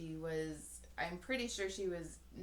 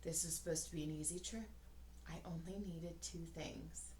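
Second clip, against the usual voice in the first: lightly voiced weary mom, softer; 3 semitones lower, 9 dB quieter than usual. Tired mom in checkout, lightly voiced weary. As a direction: sigh it out as a worn-out mother. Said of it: "and the mom goes".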